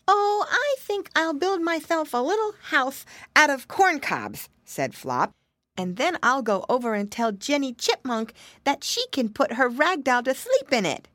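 put-on high, cheerful piggy boy; little pig voice. A narrator's reading. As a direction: chirp it like a playful piglet. Flop answers his brother.

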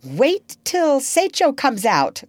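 slow, high-pitched grumble; growled. A narrator's reading. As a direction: grumble back at the captain. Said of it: "growled Starkey".

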